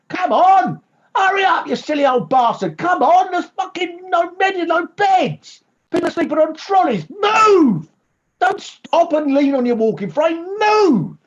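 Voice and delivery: very high, full-force impatient shouting; drill-sergeant bellowing. Acted. Comedian bullying a ninety-nine-year-old round the garden, impatient shouting. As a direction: yell furiously to hurry someone along. Yelling at old man to walk.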